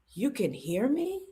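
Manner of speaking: slow, soft question swooping upward; hushed disbelief. Retold or imitated. Daughter realizing her daddy hears her, hushed disbelief. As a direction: ask it softly, stretched out in wonder.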